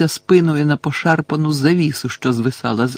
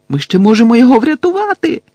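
high, loud, rushed protest; frantic high-pitched insistence; desperate shout; 9 semitones higher, 7 dB louder, faster than usual. A narrator's reading. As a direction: shout it fast, rising in desperation. Harry desperately insists on rescue.

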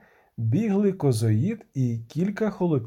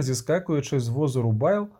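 usual speaking voice

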